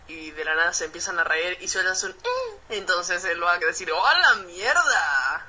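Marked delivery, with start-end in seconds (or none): none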